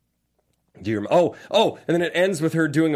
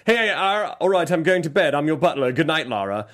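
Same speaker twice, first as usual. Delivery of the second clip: 1 mock butler; 4 semitones higher, 5 dB louder than usual. Mocking the butler's bedtime line.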